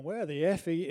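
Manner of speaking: loud swearing demand on the phone; a drunk man yelling at the ambulance service, hammed-up anger; mock angry shout. Taking over the angry call.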